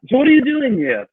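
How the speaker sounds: put-on a quick stab at a British accent like Anthony Hopkins. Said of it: "try it".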